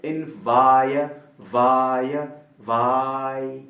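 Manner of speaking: वाय drawn out long and open; isolated vai sound. Shown as a demonstration. Teacher isolating the sound under discussion, long slow वाय. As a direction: stretch the "ai" in वाय, piece by piece. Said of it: "जो जेर बहस है वो ये है".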